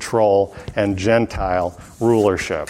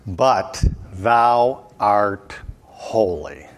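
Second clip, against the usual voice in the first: very slow, each word set apart; weighty scripture reading, drawn out with pauses; solemn, deliberate reading; 3 semitones lower, much slower than usual. Acted. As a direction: let every word of the verse land slowly. Key verse read as the answer.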